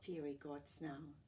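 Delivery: plain reading voice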